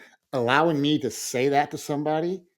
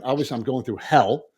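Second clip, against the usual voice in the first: usual speaking voice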